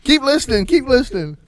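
loud, high-pitched mock begging; mock urgent plea. Host pleads with listeners not to quit, mock urgent. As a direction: call out to listeners, hammed-up.

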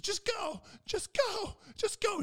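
very high, loud strained plea; desperate teammate. Retold or imitated. Teammate begging friends to leave, a very high strained plea. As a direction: squeak it out in desperation. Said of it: "he goes".

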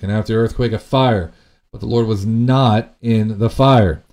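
preacher reading the storm passage; dramatic scripture reading. Acted. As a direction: read it like thunder rolling past.

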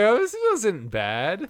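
high, very slow mock verdict; mock unimpressed viewer. Imitating a viewer judging the movie, slow and high. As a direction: drag it out, lightly mocking. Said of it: "He was like".

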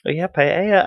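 high, put-on Spanish accent; lightly hammed Spanish accent voice; mock Spanish accent. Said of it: "if I was to do".

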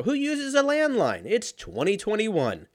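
very high-pitched mock disbelief; incredulous. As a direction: draw it out in high, incredulous protest. Retold incredulous reply to sales call.

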